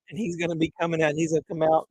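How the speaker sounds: ordinary delivery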